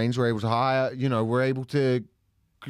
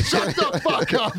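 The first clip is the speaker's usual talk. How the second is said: higher, louder, slow mock snarl; gruff husband snap; 11 semitones higher, 4 dB louder than usual. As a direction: bark it like a brute husband. Fifties husband parody.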